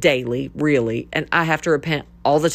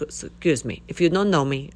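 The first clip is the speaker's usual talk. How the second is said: usual speaking voice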